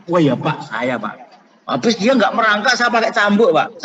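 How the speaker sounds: a father spilling it out, rising to a near shout; father's loud confession. Retold or imitated. Father admits whipping his son, loud confession.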